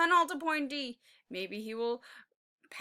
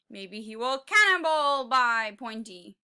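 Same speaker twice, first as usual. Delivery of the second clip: plain reading voice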